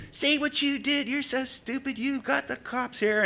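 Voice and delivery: high-pitched imitation of a bickering couple; mock yelling squabble. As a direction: nag accusingly in a put-on high voice. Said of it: "they're going at it, and they're yelling".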